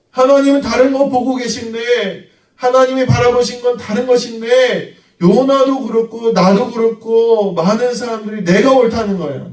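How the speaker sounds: fervent sermon delivery; full-force preaching. Acted. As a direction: preach it out to the congregation.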